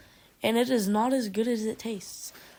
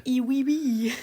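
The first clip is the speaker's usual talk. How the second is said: mock French; 3 semitones higher than usual. Mocking French accent.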